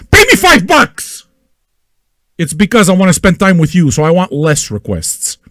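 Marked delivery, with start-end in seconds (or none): 0.1-1.2 drawn-out, very high put-on voice; high mock demand. Host demanding money for requests, in a high mock voice.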